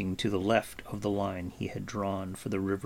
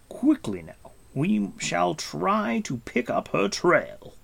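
raised, decisive leader's voice; Gwydion; 8 semitones higher than usual. Acted. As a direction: set out the plan firmly. Gwydion decides the route.

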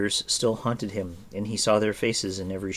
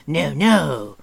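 loud, high protest; whimpering creature's protest; 7 semitones higher, 11 dB louder than usual. A narrator's reading. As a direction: wail it out, pitched up and loud. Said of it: "Gurki protested".

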